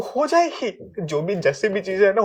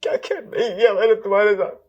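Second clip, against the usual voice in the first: tearful, pleading question; crying; 3 semitones higher than usual. Acted. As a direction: sob it out to your wife. Crying apology. (रोते हुए सवाल)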